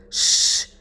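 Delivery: long unvoiced "shh"; sharp shush. Commander silences a questioning subordinate, hushing.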